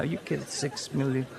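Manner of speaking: ordinary talk, nothing marked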